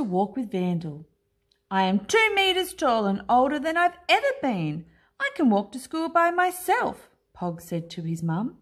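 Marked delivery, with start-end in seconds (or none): 1.7-7.1 insistent Pog. A narrator's reading. Pog insists to his mum.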